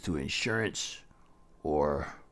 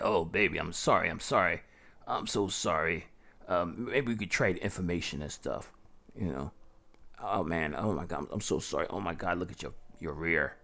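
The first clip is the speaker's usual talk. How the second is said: louder, overdone sorry-sorry act; fake apology; 4 dB louder than usual. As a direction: ham up a false apology to the woman. Fake apology after ramming her.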